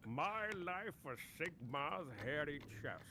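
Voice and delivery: booming Sigmar voice. Pretend. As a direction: declaim it like a war god. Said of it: "booming Battleman voice impression".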